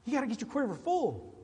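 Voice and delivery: high, fast, excitable nagging voice; mimicking a spazzy church member; hyper church member. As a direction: rattle it off like an overeager busybody. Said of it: "he was a spaz".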